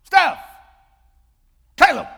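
put-on loud high shout of each child's name; mother calling her kids, loud and high. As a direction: holler the names across the house. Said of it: "And when I said".

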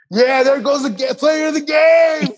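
high, loud sarcastic cheer, slowing at the end; mocking parent shout. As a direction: yell it like a gloating rival parent. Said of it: "parents were like".

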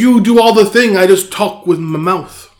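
very high, very loud protest; mock-exasperated shout. Co-host disowning the podcast work, shouted protest.

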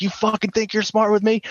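high, strained threatening question; retold cop anger. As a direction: imitate an outraged cop. Cop grabs uncle by throat.

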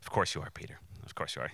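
low, offhand mock agreement; dry humoring. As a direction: humor him under your breath. Dry aside answering Peter's pledge.

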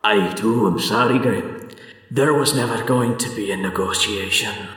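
understated, sorrowful in-character reply; lightly voiced villain, resigned and hushed at the name; regretful fey villain. Villain answers Grim's apology, mild resigned regret.

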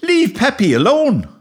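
high-pitched protest; shouted order. A narrator's reading. Boy shouting at the spaniel to leave his dog. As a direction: yell it high, defending your pet. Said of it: "I shouted at the spaniel".

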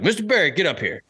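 lightly voiced stern coach calling him up; mock command from the front of the room; booming coach voice. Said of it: "booming voice".

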